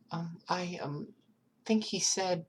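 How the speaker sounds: put-on high, timid small child voice; scared child answering a detective, high and hesitant; little girl voice. Eri tries to remember.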